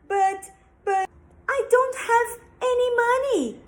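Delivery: high frightened stammer; scared pleading. Acted. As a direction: plead in a panic. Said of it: "he said".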